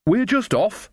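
high, cheery sign-off; bright. A narrator's reading. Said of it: "said Nanny Ogg brightly".

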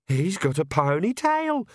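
high, slow excited squeak. A narrator's reading. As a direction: squeal it out like a smitten girl. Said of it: "squeaked Perdita".

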